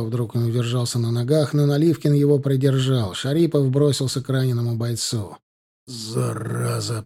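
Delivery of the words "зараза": slow, softer strained curse; drawn-out, effortful mutter; puffing with effort. A narrator's reading. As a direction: grunt it while straining over a wound. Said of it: "пыхтел Наливкин".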